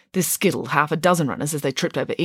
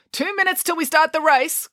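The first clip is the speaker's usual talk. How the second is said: high-pitched race marshal's announcement; calling out; 12 semitones higher than usual. Acted. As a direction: call it out to a crowd of runners. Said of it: "called the vice principal".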